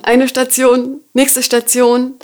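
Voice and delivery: ordinary talk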